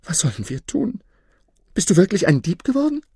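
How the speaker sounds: high, anxious questions speeding up; desperate wife. A narrator's reading. Wife asks if husband turned thief, desperate. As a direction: plead for an answer in alarm. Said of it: "rief seine Frau verzweifelt".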